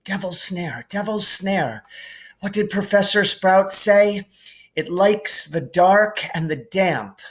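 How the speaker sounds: put-on a touch of panicked girl; Hermione remembering. A narrator's reading. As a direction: rack her memory aloud. Hermione thinking aloud.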